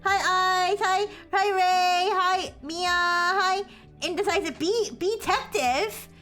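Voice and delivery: high, bright cute greeting voice; cute anime streamer. Real, not acted. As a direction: squeal hellos to the raiders.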